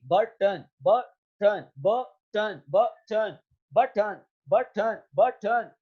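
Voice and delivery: बटन repeated, with the न said as its own beat; teacher repeating बटन to show the syllabic न; button drill. Shown as a demonstration. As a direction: drill बटन again and again, stretching the न. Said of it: "अब ये एक".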